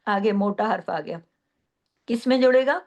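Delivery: normal talking voice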